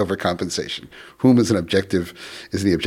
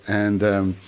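ordinary talk

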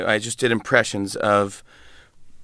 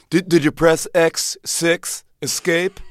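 higher, slow, condescending computer expert; annoying IT guy; 6 semitones higher, slower than usual. Acted. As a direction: spell it out slowly as if to a fool. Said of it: "I T guy at the office who's annoying".

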